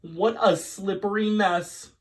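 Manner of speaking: high and loud, fed up; exasperated exclamation. Acted. Missus Greenberg to Rachel over the slippery floor, exasperated. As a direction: throw up your hands at it.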